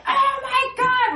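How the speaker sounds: high, loud yelp of sudden pain; mock agonised exclamation; shocked cry. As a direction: blurt it out as the pain strikes. Appendix pain suddenly hits.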